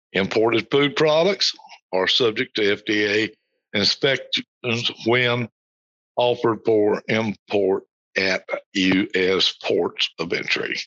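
slow, deliberate reading; slow, careful statement of the rule; said slowly. Shown as a demonstration. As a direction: read it out slowly so each word sinks in. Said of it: "I said that slowly".

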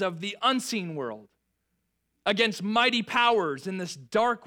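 measured, solemn reading of Ephesians; scripture reading. Acted. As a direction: read it like a proclamation over the church. Reads scripture to congregation.

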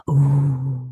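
ordinary talk

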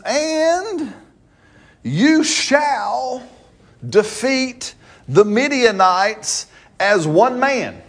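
lightly voiced God, slow and lifted; God's promise. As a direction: draw out each word like a vow. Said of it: "I said".

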